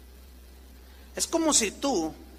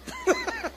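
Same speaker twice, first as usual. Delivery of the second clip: high laugh; 4 dB quieter than usual. Real, not acted. Pastor laughs at own joke.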